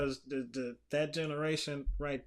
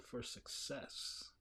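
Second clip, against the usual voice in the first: library whisper; 2 semitones lower, 12 dB quieter than usual. Acted. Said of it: "that's the library voice".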